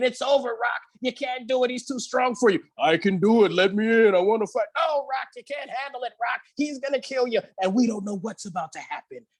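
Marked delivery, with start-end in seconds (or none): 0.0-2.6 a hint of a gruff, urgent trainer; lightly voiced coach warning his fighter; trainer's warning. Speaker quoting Rocky's trainer, lightly voiced and urgent. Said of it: "his trainer's all in his ear saying". 2.8-4.7 lightly voiced boxer insisting; a hint of a stubborn fighter; Rocky pleading. Speaker quoting Rocky to his trainer, lightly voiced. 4.8-7.4 trainer's warning. Retold or imitated. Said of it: "his trainer's all in his ear saying".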